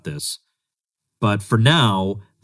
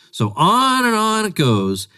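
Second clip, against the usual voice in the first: weary high drawl; 10 semitones higher, slower than usual. Real, not acted. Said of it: "weary sigh".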